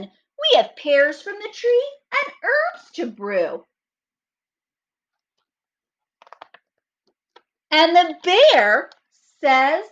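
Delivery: put-on slow, lively read-aloud for children; storybook reading. A narrator's reading. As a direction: read it like story time for little kids. Reads story narration aloud.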